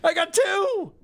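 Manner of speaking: high, loud victory crow; real high-pitched triumphant outburst; gleeful boasting. Real, not acted. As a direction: crow excitedly about your score. Celebrating score in quiz game.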